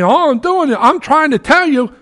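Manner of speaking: high, loud, pleading protest; Paul protesting his innocence before the council; impassioned plea. Retold or imitated. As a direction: cry out that you're sincere. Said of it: "Paul's saying".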